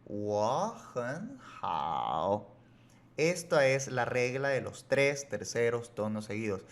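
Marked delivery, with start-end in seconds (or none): 0.1-2.5 "Wo hen hao" very slow and softer, climbing through "hen", "hao" low; correct tone change. Shown as a demonstration. Teacher repeating the right way, "Wo hen hao" rising into a low "hao". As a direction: glide up over "Wo hen", settle low on "hao". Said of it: "Así".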